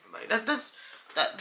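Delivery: usual speaking voice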